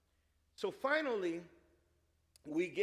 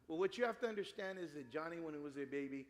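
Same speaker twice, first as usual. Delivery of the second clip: normal talking voice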